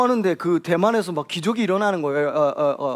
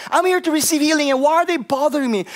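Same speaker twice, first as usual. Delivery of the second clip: angry; 9 semitones higher, 5 dB louder than usual. Retold or imitated. Woman complaining about her church bosses, angry. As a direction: spit out the grievance. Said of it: "and she got really angry".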